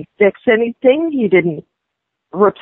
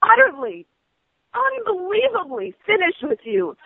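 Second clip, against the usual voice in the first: high pitched, each word spaced apart; seething finish; 6 semitones higher, 3 dB quieter than usual. Real, not acted. Customer tells the rep she's finished, seething. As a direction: spell out how done you are.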